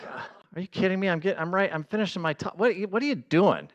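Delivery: high, exasperated disbelief; mock-outraged high pitched protest; incredulous protest. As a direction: play it up, outraged that she'd make you cry. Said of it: "And I'm like".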